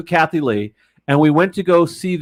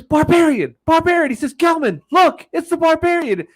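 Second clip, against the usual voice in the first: calling out excitedly; 13 semitones higher than usual. Retold or imitated. Regis calling out to fan across the hotel, high and excited. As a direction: hail her across the crowd with delight. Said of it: "he's just screaming out".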